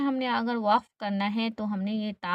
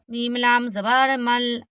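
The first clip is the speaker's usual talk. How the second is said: usual speaking voice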